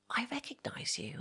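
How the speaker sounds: whispered. Retold or imitated. Retold shop girl approaches.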